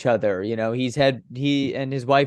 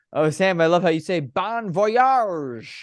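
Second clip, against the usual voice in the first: imitated Australian fan, slow and higher at the end; Aussie accent; 5 semitones higher than usual. Broadcaster imitating admiring fans in Australia, Aussie accent. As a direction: stretch the sign-off in a playful Aussie accent. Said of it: "in the Aussie accent".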